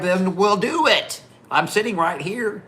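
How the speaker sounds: high, drawn-out mock dare; retold dare. Seated rider goading the man to act, retold dare. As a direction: goad him, pitched well up.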